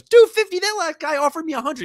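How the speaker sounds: high, loud incredulous squawk; indignant disbelief. Retold or imitated. Dad squawking at the snow shoveler in disbelief. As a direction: play the dad, outraged at the price. Dad outraged at price quote.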